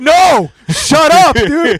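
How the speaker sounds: high, loud, drawn-out panicked yell; retold frantic shushing. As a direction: reenact desperately shouting someone down. Reenacts hushing Mark mid-set.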